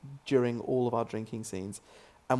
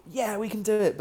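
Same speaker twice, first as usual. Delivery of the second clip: much higher, a cocky can-do boast; mock gung-ho cheer; 10 semitones higher, 4 dB louder than usual. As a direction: sell it like the cast is hyped to actually drink. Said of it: "we were like".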